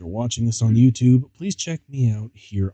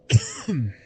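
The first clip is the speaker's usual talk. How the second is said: coughing fit; 2 semitones higher than usual. Real, not acted. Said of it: "Sorry I'm coughing so much".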